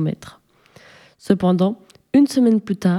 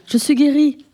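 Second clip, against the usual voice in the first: high, bursting cry of joy; joyful cry; 7 semitones higher than usual. A narrator's reading. Sick young man cries out he is cured. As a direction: cry it out, suddenly healed. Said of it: "Puis il s'écria".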